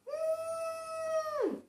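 high muffled squeak; muffled high cry. Acted. Cucumber gagged, muffled high squeak. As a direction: squeal with lips shut. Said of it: "cried Cucumber".

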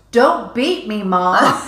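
put-on child yelling. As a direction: play the toddler shouting in the store. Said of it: "at the top of her voice".

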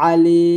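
আলি opening on a squeezed, loud ayn; ayn pronunciation. Shown as a demonstration. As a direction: press the throat on the first vowel of আলি, stretch the লি. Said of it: "আইনটা উচ্চারণ করবেন".

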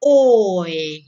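Oi said loudly, round lips opening wide; loud model oi. Shown as a demonstration. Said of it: "bibir Anda menjadi lebar kembali". (Oi keras dengan bibir bundar)

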